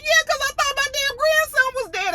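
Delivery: imitating Kate's high indignant retort; indignant grandmother. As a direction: fire it back in a high voice. Kate snaps back at JP.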